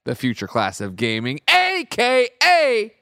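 slow, loud, high ring announcer call; wrestling ring announcer, drawn out; announcer intro. Acted. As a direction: bellow each title like introducing a wrestler. Hyped co-host introduction.

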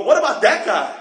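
mock-whiny, rising complaint with a laugh; teasing impression of a jealous complainer; whiny complaining. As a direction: play the jealous believer grumbling at God. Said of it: "And you start complaining".

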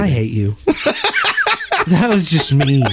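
laughing through mock outrage; laughing protest. Real, not acted. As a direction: protest while cracking up. Teased friend protests laughing.

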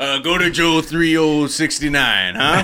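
high, slow mock politician; exaggerated candidate impression; hammed-up candidate plug. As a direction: pitch it like a bumbling campaign plug. Acts out candidate's garbled plug.

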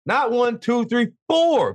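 excited. Real, not acted. Hyping the network's big win.

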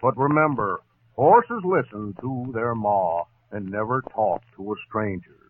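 ordinary delivery

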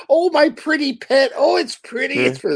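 hammed-up high doting coo; doting on the bike. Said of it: "he's like".

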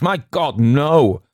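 high, loud "no!" after "My God"; emphatic "no" driven hard; extraordinary emphasis. A narrator's reading. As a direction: cry "My God, no!" in horrified refusal. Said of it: "with extraordinary emphasis".

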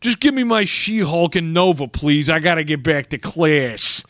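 hammed-up New York impatience; mock New York accent. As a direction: brush them off in broad New Yorkese. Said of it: "in that accent".

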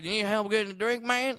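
high-pitched, terrible comedian impression; botched impression sliding towards a country comic; Dave Chappelle. Comedian offering bar help, terrible impression. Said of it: "is this Dave Chappelle or Larry the Cable Guy".

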